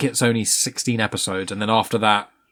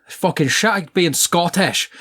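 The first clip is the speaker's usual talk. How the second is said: higher, drawn-out mock Scottish; hammed-up Scottish accent; 6 semitones higher, slower than usual. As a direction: put on a slow, exaggerated Scottish accent. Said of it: "is written in phonetic Scottish".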